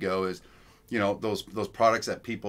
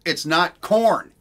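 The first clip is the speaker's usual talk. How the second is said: corn slowed and stretched, louder; emphatic; 7 semitones higher, 7 dB louder, much slower than usual. Real, not acted. Emphatic punchline about GMO corn.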